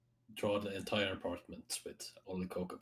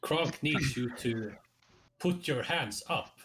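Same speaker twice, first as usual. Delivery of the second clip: stiff commanding robot cop; 6 semitones higher, 4 dB louder than usual. Acted. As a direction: order them like a robot officer. In-character Kronk robot cop.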